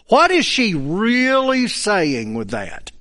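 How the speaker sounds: ordinary talk, nothing marked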